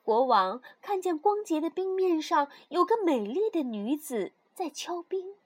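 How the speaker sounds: ordinary delivery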